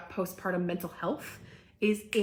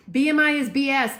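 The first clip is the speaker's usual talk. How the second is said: loud, high outburst; indignant shout; yelling; 7 semitones higher, 10 dB louder than usual. Real, not acted. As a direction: blurt it out fired up. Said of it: "I'm not gonna yell about this".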